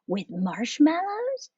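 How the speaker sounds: high, drawn-out plea; begging. A narrator's reading. Kitten girls beg Mama for marshmallows, begging. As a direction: plead slowly like little girls. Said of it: "begged the girls".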